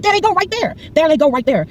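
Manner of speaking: high-pitched, urgent calling out; excited, high cry of alarm; urgent alert. Retold or imitated. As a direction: act out frantically pointing someone out. Retold alerting police to returning culprits.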